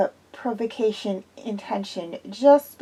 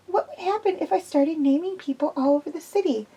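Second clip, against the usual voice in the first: lightly raised, anxious woman's voice; nervous young woman; 7 semitones higher, 3 dB quieter than usual. A narrator's reading. Zoe tells Quillian she is nervous, lightly voiced anxious woman. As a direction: play Zoe uneasy and jumpy.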